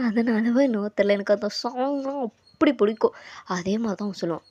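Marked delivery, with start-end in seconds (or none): none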